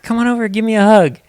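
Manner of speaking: high, loud, fast come-here voice; bright loud fast invitation; coaxing toddler. Retold or imitated. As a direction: call a little kid over.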